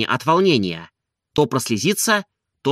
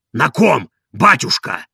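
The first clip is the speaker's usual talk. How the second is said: put-on hoarse old servant's eager question; Zakhar; 4 dB louder than usual. A narrator's reading. Servant asks whom the gentleman married, hoarse voice. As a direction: rasp it out, husky and curious. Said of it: "спросил Захар".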